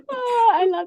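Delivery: high, laughing through the words; real laughter bubbling into words. Real, not acted. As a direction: laugh out the delight. Laughing at friend's story.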